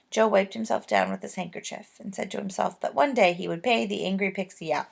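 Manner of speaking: usual speaking voice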